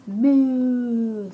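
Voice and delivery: long, loud cartoon moo; cow moo. Voicing the cow.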